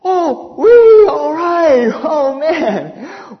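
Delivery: high, loud whoop dissolving into a laugh; joyful shout then laughing; overjoyed whoop. Retold or imitated. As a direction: yelp with sheer delight at the news. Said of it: "Boy, I was so happy".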